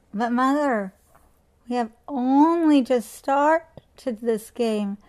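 put-on loud, pleading child's whine; whining child. A narrator's reading. Children whining to their mother to stay up late. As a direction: beg and whine like a kid at bedtime. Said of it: "whining and complaining".

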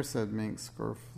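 usual speaking voice

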